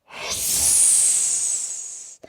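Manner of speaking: long breathy hiss; angry cat hiss. Acted. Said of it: "hiss like an angry cat".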